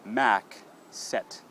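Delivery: "Mac set" ending on the consonants k and t; final vowels cut. Shown as a demonstration. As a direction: say Mac, then set, no vowel after either. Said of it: "cut that last sound".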